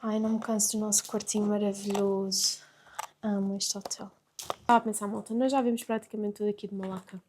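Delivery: soft, low late-night murmur; hushed hotel-room voice, keeping it down; falar baixinho. Real, not acted. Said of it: "estou a falar mais baixinho". (voz baixinha de fim de noite)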